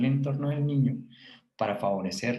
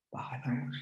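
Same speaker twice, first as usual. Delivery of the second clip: soft unvoiced whisper, much softer; whispered command; 10 dB quieter than usual. Shown as a demonstration. Teacher models calming a child by whispering, soft whisper. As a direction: hush the child by whispering it yourself. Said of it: "yo voy a bajar mi voz y voy a hacer este".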